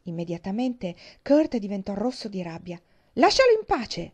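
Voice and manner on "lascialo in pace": much higher, much louder yell; furious shout. Acted. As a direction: shout him off in a rage. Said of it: "gridò". (urlo furioso)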